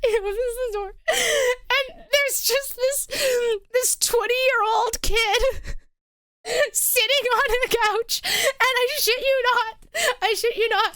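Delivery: high voice, fighting back real laughter; laughing while telling. Real, not acted. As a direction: struggle to get the story out through giggles. Teller cracking up at own story.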